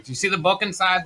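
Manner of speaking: smoker mother's voice. Retold or imitated. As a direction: ask as mom over speakerphone. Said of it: "I always wanna do her voice".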